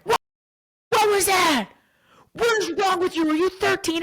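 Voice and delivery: high, loud mock outrage at the ref; hockey mom yelling. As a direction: scream at the ref from the bench. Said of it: "I'm screaming on the bench".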